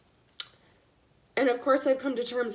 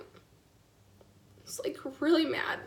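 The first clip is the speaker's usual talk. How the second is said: whispering through tears; words barely voiced, crying; tearful whisper; 4 semitones higher, much slower than usual. Real, not acted. Mother tells viewers her anger, whispering through tears. Crying while telling story.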